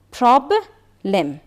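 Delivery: "پرابلم" slowly broken as prob... lem; slow two-beat "پرابلم" for learners; split into syllables. Shown as a demonstration.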